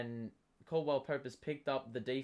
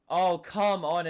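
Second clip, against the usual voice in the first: exasperated; 3 semitones higher, 7 dB louder, slower than usual. Real, not acted. Complaining about the call.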